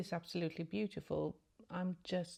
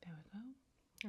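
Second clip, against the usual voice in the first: whispering; 2 semitones higher, 10 dB quieter, slower than usual. Real, not acted. Soft aside while doing makeup.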